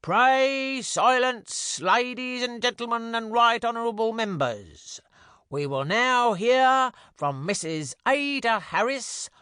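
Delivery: high, slow ceremonial announcement; pompous herald's cry. A narrator's reading. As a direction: call the House to order like a herald.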